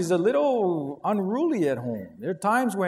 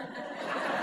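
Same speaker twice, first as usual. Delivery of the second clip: quiet breathy laugh; 10 dB quieter than usual. Real, not acted. Laughing at his story.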